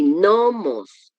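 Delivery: "nomos" slowly, long stressed NO; stress on first syllable. Shown as a demonstration. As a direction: stretch NOO-mos, falling away. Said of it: "dónde está el acento para hacer la fuerza".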